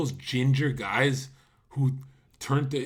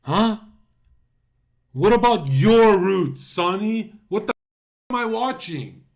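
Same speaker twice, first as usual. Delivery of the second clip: loud, slow mock shout of what about your roots; 7 semitones higher, 8 dB louder than usual. Real, not acted. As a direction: call him out loudly and slowly, jeering. Mock-confronting Sonny over roots.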